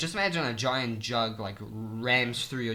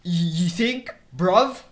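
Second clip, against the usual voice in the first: loud, high-pitched British challenge; British accent; 6 semitones higher, 6 dB louder, slower than usual. Acted. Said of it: "now I just do it like how they actually say it".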